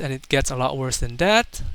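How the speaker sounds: ordinary talk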